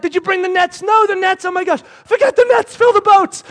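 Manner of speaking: high, urgent back-and-forth building to a yell; panicked fishermen. Acted. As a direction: shout it in a frantic rush. Acts panicked partners in the boat.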